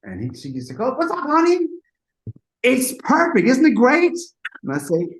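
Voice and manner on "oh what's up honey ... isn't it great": high, loud, bubbly imitation; gushing retold greeting. Singer brushing off the producer's plea, gushing.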